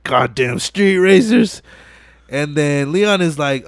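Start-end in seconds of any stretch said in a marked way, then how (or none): 0.0-1.6 put-on slow, grumbling old-man complaint; grumbling director. Director cursing the racers, slow grumble. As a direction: drag it out like a fed-up boss.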